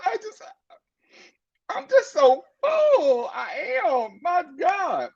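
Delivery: high, slow mock sob, half shouted; hammed-up overwhelmed wail; mock crying. Overwhelmed host gushing to guests.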